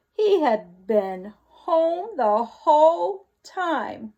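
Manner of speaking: lively read-aloud storyteller voice; story narration. Acted. Teacher reading the wolf's tale to the class, animated narration. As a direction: read it with big expressive swings.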